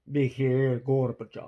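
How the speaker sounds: Mikhail Gorbachev slowly, in a Russian accent; slow Russian accent on the name. Shown as a demonstration. Contrasting the English name with a slow Russian accent. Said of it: "or in Russian".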